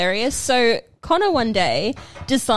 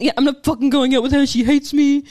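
imitating a sulky upset boy; hammed-up wounded whining; mocking sulky teen; 3 semitones higher, 3 dB louder than usual. Sister mocking hurt brother sulking about mum. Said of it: "I remember you went".

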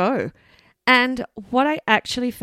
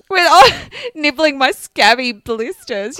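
disgusted laugh; 5 semitones higher, 7 dB louder, slower than usual. Real, not acted.

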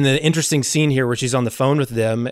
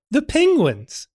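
put-on high, silly kid blurting from the back; lightly voiced class clown; mock kid voice; 13 semitones higher, slower than usual. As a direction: pipe up like a cheeky classmate. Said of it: "this disembodied voice from the class go".

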